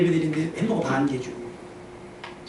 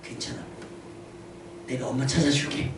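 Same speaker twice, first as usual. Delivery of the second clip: soft, low, soothing voice; gentle reassurance; 6 semitones lower, 11 dB quieter than usual. Shown as a demonstration. Adult offering to find a child's mom, reassuring. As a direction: comfort the child quietly.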